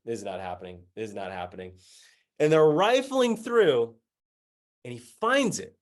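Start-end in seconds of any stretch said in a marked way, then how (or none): 0.1-1.8 low, soft disbelief to oneself; quiet, low-pitched acted dread; dismayed inner voice. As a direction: repeat it under your breath. Dismayed thought watching garbage search.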